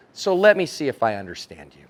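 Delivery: low-pitched condescending check; patronizing. Acted. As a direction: talk down to them. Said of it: "When you patronize somebody".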